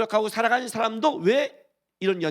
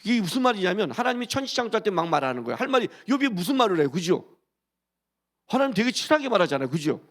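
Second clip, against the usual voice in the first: normal talking voice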